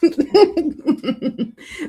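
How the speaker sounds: high, loud burst of laughter; real laugh. Real, not acted. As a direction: laugh out loud at a funny reply. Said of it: "This response is so funny".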